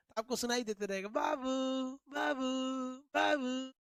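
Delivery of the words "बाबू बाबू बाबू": the name repeated and fading like an echo. Shown as a demonstration. Teacher imitating the echo of the call, fading out. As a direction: mimic the echo coming back from far away. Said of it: "ये इको है".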